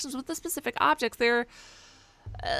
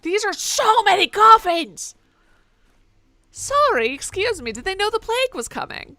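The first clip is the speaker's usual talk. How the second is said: much louder and higher, stunned; shocked outburst; 9 semitones higher, 10 dB louder than usual. Real, not acted. Player stunned by a room full of coffins, loud shocked outburst. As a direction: yell out in disbelief.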